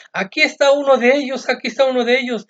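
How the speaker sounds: high, loud, pointing-out cry; accusing woman. Acted. A woman in the courtyard accusing Peter, high and loud. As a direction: shout out to the crowd that you found one of them.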